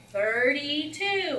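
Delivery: slow, high chant; class answer in unison. Retold or imitated. Class chants the answer together, slow. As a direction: drag out Thirty-two like a class chanting. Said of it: "you're all going to say".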